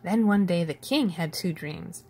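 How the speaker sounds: usual speaking voice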